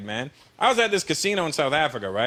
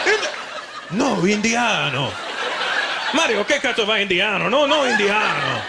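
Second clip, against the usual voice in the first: Italian freaking out; 2 semitones higher than usual. Acted. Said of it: "he would freak out".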